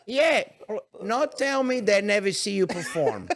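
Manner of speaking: put-on high old-lady impersonation; elderly woman. Grandmother sticking up for the aunt and uncle, high-voiced imitation. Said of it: "She was, like, eighty-five years old".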